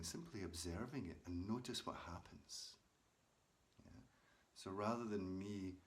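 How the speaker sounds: calm guided meditation read to listeners. Acted. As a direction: guide them gently into watching the breath. Leads breath meditation for viewers.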